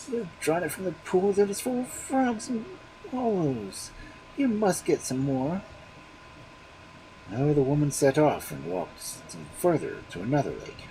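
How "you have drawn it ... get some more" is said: high, fault-finding voice; disgusted refusal. A narrator's reading. Husband refusing the water, high and disgusted. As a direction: turn the water away with distaste. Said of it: "turned away in disgust".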